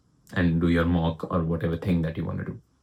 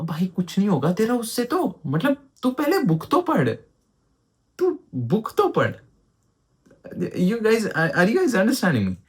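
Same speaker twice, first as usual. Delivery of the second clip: much higher, pleading exasperation; exasperated scolding; 11 semitones higher, much faster than usual. Real, not acted. Tutor scolding students who skip the textbook, high-pitched exasperation.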